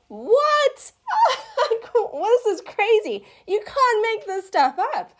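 high, loud astonished laugh; reliving the shock of the healing; stunned disbelief. Retold or imitated. Retelling her shock at the letter, high astonished laugh. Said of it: "I'm just sitting there going".

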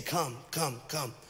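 soft, low, tender invitation; gentle call. Acted. God inviting the seeker closer, soft and low. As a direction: draw them near softly. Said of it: "he's saying".

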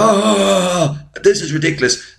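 mock scream. Imitating Quint screaming and laughing in the cinema, mock scream. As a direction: imitate a screaming heckler, voice jumping up. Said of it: "He's screaming, laughing".

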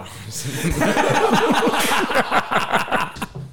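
long high-pitched laugh; high squealing laugh. Real, not acted. Teller cracks up at his own joke. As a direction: burst into a shrill laugh.

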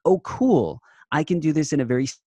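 light up at a bright idea; quick, eager relief; pleased realisation. Retold or imitated. Retold delighted realisation.

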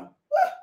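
a high, delighted woo; high excited whoop. Real, not acted. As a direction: let out a high happy whoop. Whoop after tasting coffee.

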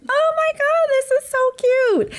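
high gushing squeal; excited squeal. Retold or imitated. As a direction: gush like you just saw something adorable. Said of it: "I was like".

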